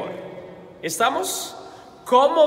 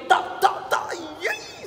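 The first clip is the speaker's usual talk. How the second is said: hammed-up Colombian rant, much higher; Colombian outburst; 10 semitones higher, much slower than usual. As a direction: let the Colombian temper burst out. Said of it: "se me salió el colombiano". (arranque colombiano exagerado y agudo)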